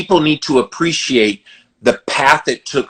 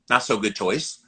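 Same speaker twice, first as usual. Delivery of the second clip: dry correction; 3 semitones lower, 6 dB quieter than usual. Retold or imitated. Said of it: "Okay, good choice".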